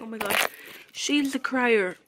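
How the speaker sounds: ordinary talk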